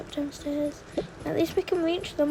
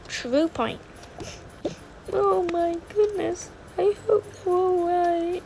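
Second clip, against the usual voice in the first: ordinary talk, nothing marked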